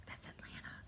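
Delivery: a quick breathy whisper tucked into the story; whispered aside. Real, not acted. Storyteller whispers to viewers which city she means, hushed. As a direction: drop to a whisper for the secret aside.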